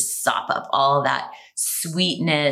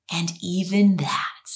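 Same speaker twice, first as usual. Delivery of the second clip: whispered. Shown as a demonstration. Self-acceptance quote, whispered.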